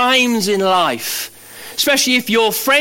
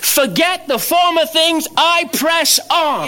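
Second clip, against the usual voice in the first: higher, slow, ringing declaration; proclaiming shout; 8 semitones higher than usual. Acted. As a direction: call it out like a battle cry, slow and higher. Preacher proclaims at full force.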